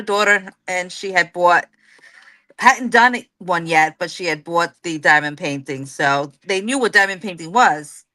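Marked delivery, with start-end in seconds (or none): none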